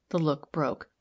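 usual speaking voice

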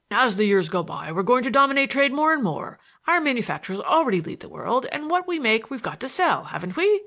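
emphatic speechifying, rising to a demand. A narrator's reading. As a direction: hold forth, then press for agreement. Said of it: "demanded Taylor".

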